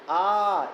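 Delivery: आ drawn out slowly over three beats; prolonged pluta aa. Shown as a demonstration. Teacher showing the class the extra-long pluta आ. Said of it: "ये हो गया प्लुत".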